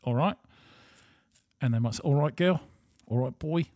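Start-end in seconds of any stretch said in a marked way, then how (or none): 0.0-0.4 imitated English greeting, louder "All right?" rising at the end; English accent, lifted questioning end. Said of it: "when the English say". 2.0-3.7 teasing Essex-style greeting, a bit higher and louder; mock English street greeting; English accent impression. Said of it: "try and take the piss and take the accent off".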